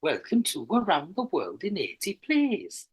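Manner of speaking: slow, bouncing Welsh sing-song; Welsh accent. Shown as a demonstration. Retrying after the co-host's version, slow lilting Welsh accent. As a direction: exaggerate each rise and fall in Welsh. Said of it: "that's much better than mine".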